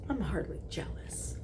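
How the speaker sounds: sulky breathy denial; hushed huffy denial; pouty whisper. Acted. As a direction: whisper it, pouting. Pouting denial.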